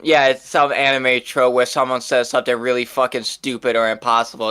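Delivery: ordinary delivery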